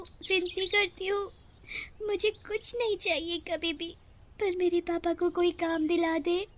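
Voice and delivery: put-on high, pleading young girl's voice; girl's tearful prayer. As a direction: beg the goddess like a small daughter close to tears. Siya prays to goddess. (एक छोटी बच्ची की रोती हुई विनती)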